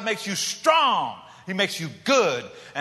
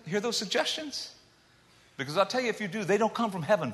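ordinary delivery

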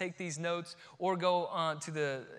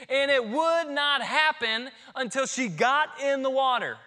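full-force preaching; 7 semitones higher, 7 dB louder than usual. Acted.

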